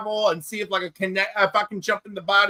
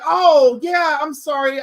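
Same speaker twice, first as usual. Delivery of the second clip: retold friend apology; 5 semitones higher, 7 dB louder, slower than usual. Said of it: "he's like".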